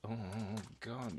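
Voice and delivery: ordinary talk, nothing marked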